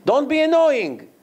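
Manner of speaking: put-on high, sharp irritated snap at a child; angry father. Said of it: "he got angry and said".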